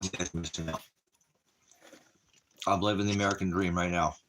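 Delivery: chewing while talking; words round a bite of food; mouth full. Real, not acted. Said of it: "Talking with my mouth full".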